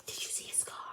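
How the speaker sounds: hushed gossip whisper. Acted. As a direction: whisper it like a secret spotted. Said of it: "Whispers followed Harry".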